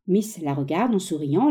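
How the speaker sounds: ordinary delivery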